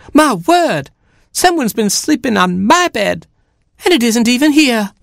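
high startled mother hen; shocked hen. Acted. Mother hen finding her bed missing, high shock. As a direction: gasp in hen outrage.

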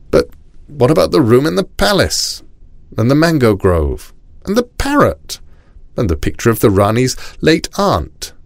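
high, protesting list; fretting Rajah. A narrator's reading. The Rajah asking about palace and parrot, high fretting voice.

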